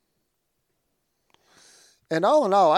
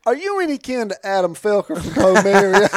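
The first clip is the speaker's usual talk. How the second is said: eager question; 6 semitones higher, 7 dB louder than usual. Retold or imitated. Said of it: "And he's like".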